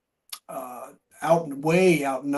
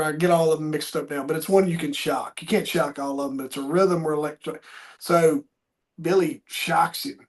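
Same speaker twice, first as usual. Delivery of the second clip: ordinary talk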